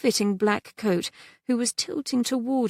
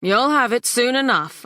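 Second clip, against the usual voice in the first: firm son; 4 semitones higher, 6 dB louder than usual. A narrator's reading. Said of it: "son confronting creditor".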